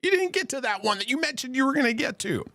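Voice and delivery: high, soft whiny viewer; mock complaining audience; mock viewer complaint. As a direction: whine like a viewers' chorus. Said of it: "you guys are like".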